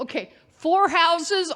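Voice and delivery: usual speaking voice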